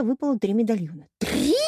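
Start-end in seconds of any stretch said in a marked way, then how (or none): 1.2-1.5 high-pitched outraged exclamation; indignant roar. A narrator's reading. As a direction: roar it in disbelief. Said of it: "возмущённо взревела Пенелопа". (возмущённый рёв)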